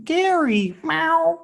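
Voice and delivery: high, squeaky cat-like meow; Gary's cat meow. Retold or imitated. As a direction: mew like a cartoon snail cat. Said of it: "like from SpongeBob, the snail is Gary".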